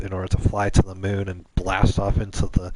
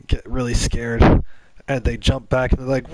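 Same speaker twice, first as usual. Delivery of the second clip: ordinary talk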